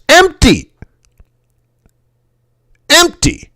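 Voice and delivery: high, marvelling repeat of empty; amazed. Retold or imitated. Describing deserted Spanish freeways, high and amazed. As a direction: float each empty up high in disbelief.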